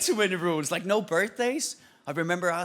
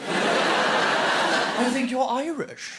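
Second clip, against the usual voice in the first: disbelieving local; 4 semitones higher, 4 dB louder, much slower than usual. Retold or imitated. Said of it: "everyone goes".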